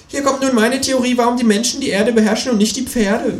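high, loud kangaroo voice; lecturing kangaroo. Acted. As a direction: share a stoned theory. Kangaroo announces theory. (dozierende, hohe Känguru-Stimme)

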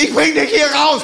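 slow, high, heroic soldier line; war movie mode. Retold or imitated. Friend in war mode rescuing the narrator, slow and heroic. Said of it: "Kriegsmodus rein".

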